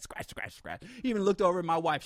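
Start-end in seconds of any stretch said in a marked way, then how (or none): 0.0-0.8 whispered scratch noises; pen scratching sound. Retold or imitated. Aggressive note-taking in the audience, whispered scratching sounds. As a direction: imitate a pen scratching on paper. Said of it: "heard the pen scratching on the notepad".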